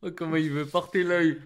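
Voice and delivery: amused outburst at a friend's jab; laughing protest. Real, not acted. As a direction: exclaim it through a real laugh.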